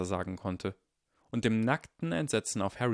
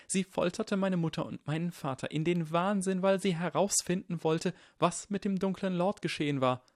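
put-on high boy's voice recounting horror; Neville, earnest and upset; 9 semitones higher than usual. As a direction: press the account on, pitched high.

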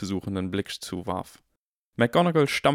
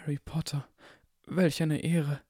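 awed barkeeper; 7 semitones higher than usual. A narrator's reading. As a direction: breathe it with reverence. Barkeeper honoured to meet Harry.

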